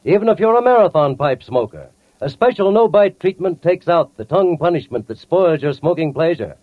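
normal talking voice

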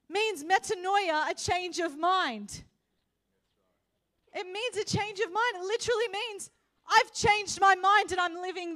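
high, emphatic sermon voice; loud, urgent preacher's delivery; full-force preaching. Real, not acted. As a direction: drive every phrase home to the church.

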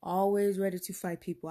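normal talking voice

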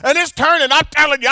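normal talking voice

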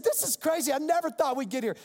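imitating an astonished attorney, high voice; high-pitched retold amazement; amazed. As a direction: marvel at how far it has gone.

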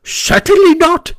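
very high, loud refusal; emphatic. A narrator's reading. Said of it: "replied Toad emphatically".

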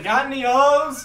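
slow, high, loud holler; retold shout. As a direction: imitate the guy yelling from his car. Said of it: "he shouts to me".

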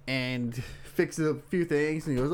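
normal talking voice

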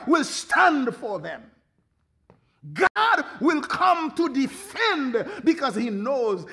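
fiery preaching. Acted. Preacher at full force.

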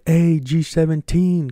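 slow, high and loud greeting call. Retold or imitated. Friends calling to him on their walk back, loud and drawn out. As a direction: hail a buddy from across the street.

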